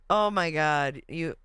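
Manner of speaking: ordinary delivery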